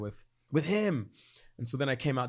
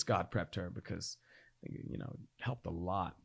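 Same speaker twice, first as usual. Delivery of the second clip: much softer, almost whispered; quiet; 4 semitones lower, 8 dB quieter, slower than usual. Real, not acted. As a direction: say it under your breath. Relieved aside.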